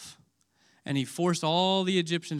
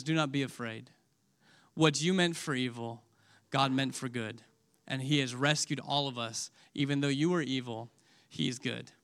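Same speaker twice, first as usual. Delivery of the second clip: soft, low forgiving words; merciful; 4 semitones lower, 5 dB quieter than usual. Retold or imitated. Joseph forgives his terrified brothers, soft and merciful. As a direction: gently reassure frightened brothers. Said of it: "the sweet words of mercy and compassion".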